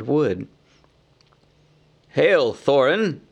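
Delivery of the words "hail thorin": slow, formal hail to Thorin; slow greeting. A narrator's reading. Bard hails Thorin at the gate, slow and formal. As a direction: draw out the greeting.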